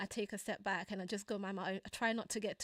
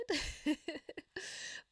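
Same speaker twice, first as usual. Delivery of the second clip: light laugh; 6 semitones higher than usual. Real, not acted. Laugh after pun.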